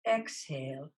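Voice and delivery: usual speaking voice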